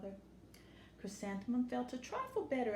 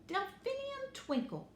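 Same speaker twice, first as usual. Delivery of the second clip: proud singsong name; slower than usual. Acted. Teacher proudly names herself.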